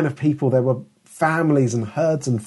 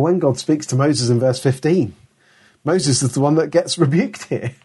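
ordinary talk, nothing marked

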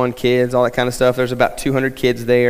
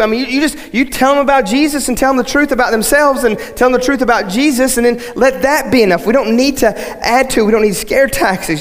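higher, urgent preaching to the church; preaching at full force; 4 semitones higher than usual. Acted. Pastor urging his church, preaching at full force. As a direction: drive the point home from the pulpit.

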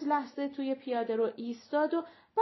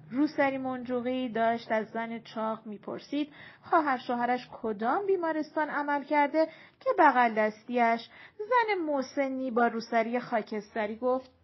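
usual speaking voice

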